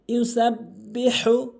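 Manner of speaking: slow, sustained chanting with long-held vowels; Quran recitation. Shown as a demonstration. Teacher opens the Quran lesson with a verse, slow melodic recitation. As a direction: recite it slowly and melodically, stretching each elongation.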